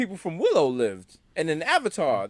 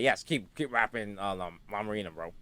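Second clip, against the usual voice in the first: 1 low mumbled encouragement; 11 semitones lower, 10 dB quieter than usual. Retold or imitated. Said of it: "Mateo was listening, like".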